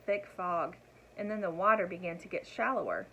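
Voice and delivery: ordinary delivery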